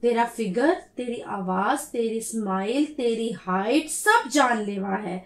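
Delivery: soft, slow flirty read; seductive reading. Pretend. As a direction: read it like a sweet-talker. Reading flirty text aloud.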